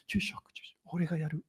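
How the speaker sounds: hushed, breathy Japanese whisper; urgent whisper. Retold or imitated. Whispering to the priest during the prayers, hushed urgent whisper. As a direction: whisper it so the grieving widow won't hear.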